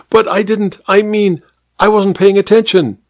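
high, nervous backpedal; flustered excuse. A narrator's reading. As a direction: fumble the excuse.

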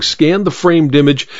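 ordinary delivery, nothing marked

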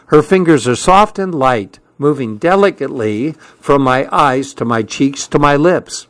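ordinary delivery, nothing marked

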